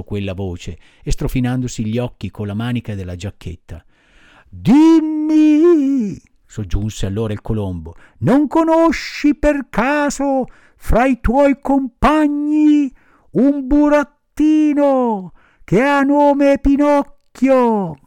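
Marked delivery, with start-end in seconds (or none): 4.5-6.2 high, slow bird-like voice calling from above; high drawn-out pigeon calling down. Acted. Pigeon speaks again. 8.2-18.0 high, slow bird-like voice calling from above; high drawn-out pigeon calling down. Acted. Pigeon asks about Pinocchio.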